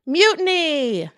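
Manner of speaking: high, loud, slow cry of mutiny; rally the crew with it; drawn-out rallying pirate yell; battle cry. Acted. Emma seizes the ship.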